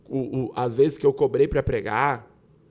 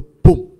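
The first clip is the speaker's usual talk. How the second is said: loud, abrupt "pum"; imitated sudden bang; 14 dB louder than usual. Dreamer jolted awake by the pastor's words, imitated bang. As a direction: punch out a waking-up jolt. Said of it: "me acordei do sonho".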